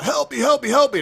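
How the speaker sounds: high-pitched cry from a trapped lion; hammed-up cry for rescue; roaring for help. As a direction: call out high, as a storytelling lion. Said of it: "he was, like, roaring out".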